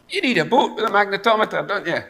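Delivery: high, quick, pushy suggestion; eager advice. Pretend. Preacher offering God his own bright idea, high eager advice. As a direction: blurt it out as if it's the clear answer.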